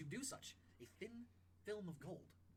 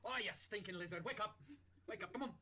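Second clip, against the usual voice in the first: loud, higher irritated chef; impatient shouted wake-up; gruff-tempered chef; 6 semitones higher, 6 dB louder than usual. Acted. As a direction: bark a sleeper awake. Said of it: "as the chef comes in and goes".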